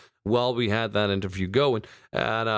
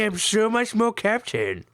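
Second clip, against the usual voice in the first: high, mocking put-on Chinese accent; 10 semitones higher than usual. Joking about the captain line with his co-host, in a high mock Chinese accent. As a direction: ham up a crude Chinese accent, pitched high. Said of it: "trying to be Chinese".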